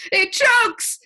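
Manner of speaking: very high, loud shout for joy; shout, jumping for joy. A narrator's reading. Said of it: "he shouted again".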